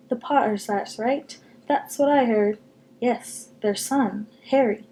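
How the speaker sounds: ordinary delivery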